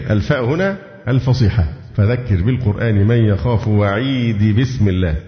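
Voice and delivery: ordinary talk, nothing marked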